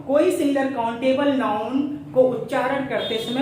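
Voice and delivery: ordinary delivery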